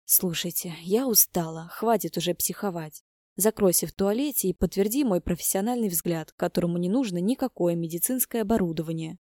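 ordinary delivery, nothing marked

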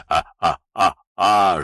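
creepy drawn-out laugh; a sinister howling cackle; eerie cackle. Acted. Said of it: "жутким смехом захохотал он". (жуткий хохот)

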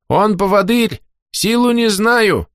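high, slow squeaky creature with a burr. Acted. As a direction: squeak it out, slightly lisping the r. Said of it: "чуть картавя запищал Хорох". (писклявый картавый голосок)

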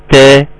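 "تا" thin, plain front t; light ta. Shown as a demonstration. Teacher contrasting two letters for students, light ta. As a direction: keep "تا" light and forward. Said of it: "وتوضح لي الفرق".

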